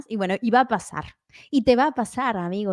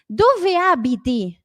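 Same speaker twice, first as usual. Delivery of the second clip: higher, louder, drawn-out "Dove abiti?"; slow model question; 4 semitones higher, 5 dB louder, much slower than usual. Shown as a demonstration. Teacher introducing where-you-live question, slow model. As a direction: spell it out slowly for learners.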